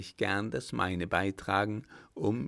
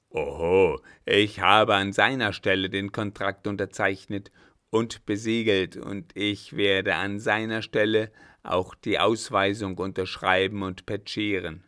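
loud, blustering assertion of power; domineering captain; 7 dB louder than usual. Acted. Captain boasts of his authority over the contract, loudly. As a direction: lord it over him.

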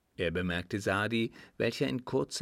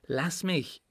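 higher, protesting female voice; lightly voiced woman; 7 semitones higher than usual. A narrator's reading. A woman whose hands are held, protesting. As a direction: raise the pitch in protest.

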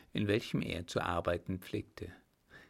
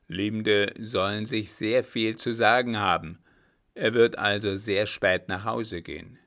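captain's voice, firmly louder; a louder grim captain demanding a straight answer; loud stern captain; 7 dB louder than usual. Acted. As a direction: press him hard, like a gruff officer. Captain lays out his plan.

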